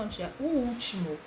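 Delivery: ordinary delivery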